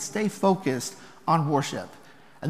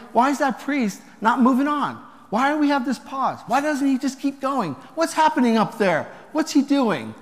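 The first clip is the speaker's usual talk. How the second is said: higher-pitched, impatient questioning; puzzled congregants; 6 semitones higher than usual. Acted. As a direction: mimic restless churchgoers wondering about the hold-up. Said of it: "some people are wondering".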